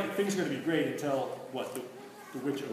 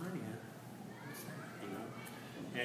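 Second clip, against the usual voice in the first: hushed, breathy feigned ignorance; much softer, sly mock puzzlement; doubtful witch whisper; 4 semitones lower, 13 dB quieter, slower than usual. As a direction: whisper it like you've never heard the word. Witch casting doubt on Narnia.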